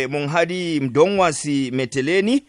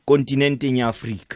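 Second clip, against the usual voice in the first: ordinary talk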